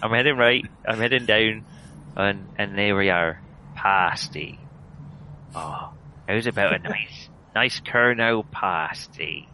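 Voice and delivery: hammed-up Cornish, savouring the vowels; West Country accent. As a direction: ease carefully into a Cornish accent. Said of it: "I'm going down Somerset now".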